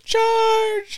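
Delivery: battle cry. Pretend. High cartoon battle cry.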